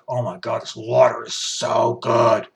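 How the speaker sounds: hushed, slowing gushing water fan; a hint of a breathless water connoisseur; mock water snob. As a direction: gush over water like a snob. Mocking bottled water fans.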